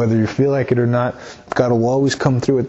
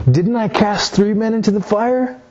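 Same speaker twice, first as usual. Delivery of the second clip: astonished king; 7 semitones higher than usual. Acted. Astonished king questions his counselors.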